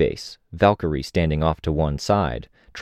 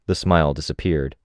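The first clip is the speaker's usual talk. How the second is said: ordinary delivery, nothing marked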